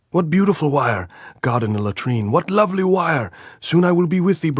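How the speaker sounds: high, loud flattering yell; shouting. A narrator's reading. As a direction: shout the praise to calm them. Andres keeps shouting.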